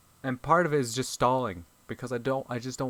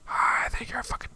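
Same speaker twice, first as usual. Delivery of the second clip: furious whisper; 12 semitones higher than usual. Real, not acted. Whispered rant at character.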